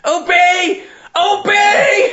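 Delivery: high, strained, shouted order; Dalek. Acted. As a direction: screech it like a tyrant machine. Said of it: "he's turning into a Dalek".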